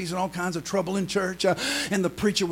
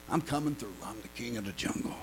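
lower, softer growl of a boasting lion; a hint of a menacing lion; roaring devil lion; 10 semitones lower, 13 dB quieter than usual. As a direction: play the devil-lion strutting as king. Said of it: "intimidation move".

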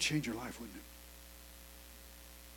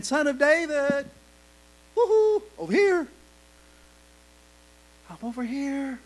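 mock casual call; 6 semitones higher than usual. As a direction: tease it as a lazy little wave. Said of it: "he's not just saying".

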